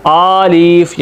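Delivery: आलिफ with a long first vowel; wrong alif. Shown as a demonstration. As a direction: stretch the opening आ in आलिफ. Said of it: "ये भी गलत है".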